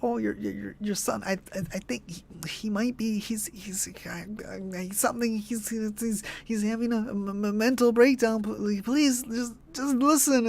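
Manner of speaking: high, halting falsetto full of false starts; stammering mock Gloria. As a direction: stammer helplessly in a put-on high woman's voice. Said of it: "it takes her so fucking long to get that sentence out".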